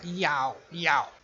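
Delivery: imitando um gato, iau baixinho; cat meow. Retold or imitated. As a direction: mimic a cat meowing iau. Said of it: "o gato dizer iau". (miado imitado de gato)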